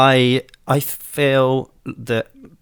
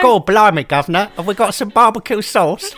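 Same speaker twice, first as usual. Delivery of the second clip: higher, louder mock cockney English accent; 10 semitones higher, 5 dB louder than usual. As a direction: ham up a cockney English accent, laughing at the end. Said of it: "someone putting on an English accent".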